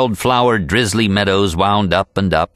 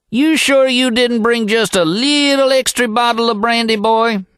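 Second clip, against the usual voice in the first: higher, teasing cowboy holler; old packer's yell. A narrator's reading. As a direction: rib the boy about the brandy. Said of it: "he yelled back a minute later".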